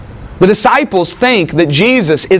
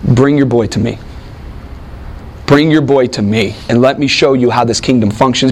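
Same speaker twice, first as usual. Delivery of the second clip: change of inflection, much lower pitch; Jesus; 8 semitones lower than usual. Acted. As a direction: drop low as you turn to address one man. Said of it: "a change of voice, of inflection there".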